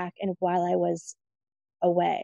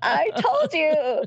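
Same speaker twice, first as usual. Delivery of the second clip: high, slow, drawn-out retort with laughter; laughing vindication; 8 semitones higher, 3 dB louder, much slower than usual. Retold or imitated. Telling cousin she was right all along, laughing vindication. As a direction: crow it through a laugh.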